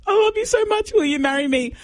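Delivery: high, hammed-up lovestruck plea; mock proposal. Retelling the contestant's on-stage proposal, hammed-up and high. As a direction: act out the kneeling proposal, gushing. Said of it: "drops to one knee and says".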